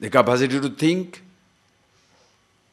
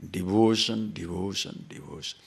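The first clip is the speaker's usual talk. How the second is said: devotion, devotion, devotion, each one softer until almost gone; fading repetition; 4 semitones lower, 6 dB quieter than usual. Acted. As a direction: let the word die away into silence. Repeated word fading away.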